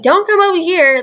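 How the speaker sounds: high, loud telling-off; exaggerated retold parental order; imitated scolding dad. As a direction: mimic a dad scolding loudly. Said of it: "He's like".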